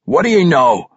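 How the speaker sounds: demanding. A narrator's reading. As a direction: press urgently for the news. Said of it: "Emerus demanded".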